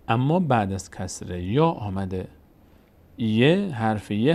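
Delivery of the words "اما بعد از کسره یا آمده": usual speaking voice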